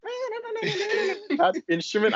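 high, drawn-out imitation breaking into laughter; laughing mock sound. Mimicking an Irish instrument for a friend, laughing.

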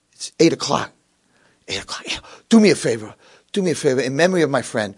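usual speaking voice

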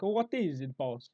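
comic Italian lilt; Italian accent. Acted. Italian worker answering his boss, mock Italian accent. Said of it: "I say Italian".

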